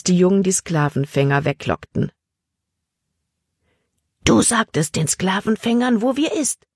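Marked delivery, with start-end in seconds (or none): 4.2-6.7 hushed accusation climbing much higher; cutting, angry whisper. A narrator's reading. Emma accuses Libby of betraying them, angry and hushed. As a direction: accuse under your breath, pitch rising. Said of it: "Emmas Flüstern war schneidend vor Wut".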